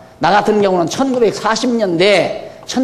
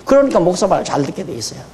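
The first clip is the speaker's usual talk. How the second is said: ordinary delivery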